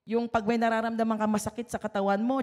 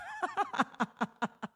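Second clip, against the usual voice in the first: high, soft burst of real laughter; laughing at herself over shopping; 9 semitones higher, 8 dB quieter than usual. Real, not acted. Laughing to the brethren about shopping stress.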